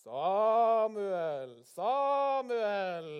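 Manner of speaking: very loud, long drawn-out call; voice calling Samuel. Acted. God calls the sleeping boy a second time, booming long call. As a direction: boom the name out, stretching each vowel.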